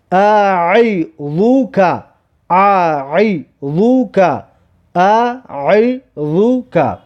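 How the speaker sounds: اعیذک stretched with a long final /aː/ and ع from the throat; slow Arabic reading. Shown as a demonstration. Teacher drilling pupils on the throat letter, slow recitation. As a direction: repeat اعیذک slowly, vowel by vowel.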